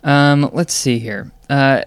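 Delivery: ordinary talk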